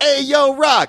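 high calling-out Philly voice; street corner call. Retold or imitated. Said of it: "that are like".

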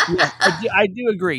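high laughing yes. Real, not acted. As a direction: agree while still laughing. Laughing agreement.

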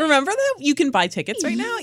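high-pitched parody of an upspeak plug; mock questioning. Co-host mimicking the ticket plug, mock questioning. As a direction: tease it as an over-eager question.

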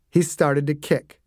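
ordinary delivery

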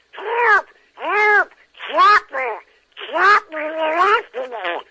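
very high, loud cartoon duckling voice; Yankee Doodle. Shown as a demonstration. Little duck calling his bulldog friend for help, squeaky cartoon panic. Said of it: "it goes like this".

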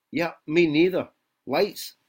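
put-on terrible American accent. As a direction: answer the device in a rough American accent. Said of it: "my terrible American accent".

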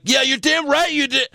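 high-pitched, emphatic comeback; mock indignant yell. Acting out Jericho's angry retort.

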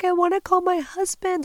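high-pitched mock wail; retold sobbing. Victim begging to phone her husband, mock sobbing. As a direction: plead in an acted-out sob. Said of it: "I am sobbing".

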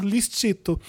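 soft, mocking sugary voice; sneering fake sweet. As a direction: say it with a sly sneer. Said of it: "Uma voz debochada falando".

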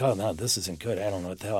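soft, dropped, uneasy voice; worried mutter. Retold or imitated. Nick, burned, worrying softly to himself. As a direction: imitate Nick's low, uneasy worry.

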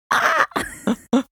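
very high, amused laugh. Real, not acted. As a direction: burst into high-pitched laughter at an absurd title. Laughs at the pompous title.

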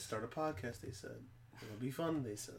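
very soft, low grumble; low, quiet sarcastic quote; wry muttered aside. Acted. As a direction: mutter it under your breath, resigned. Wry aside during derailed show.

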